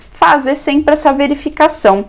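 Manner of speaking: usual speaking voice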